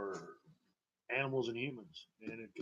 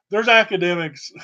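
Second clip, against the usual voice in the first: laughing; 7 semitones higher, 13 dB louder than usual. Real, not acted. Laughing through word.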